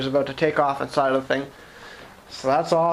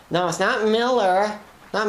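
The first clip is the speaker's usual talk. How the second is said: higher, mimicked nagging correction; 5 semitones higher than usual. Friend on his case over his accent, higher mimicked nagging. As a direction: imitate someone pedantically correcting a word. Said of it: "she was all on my case last night".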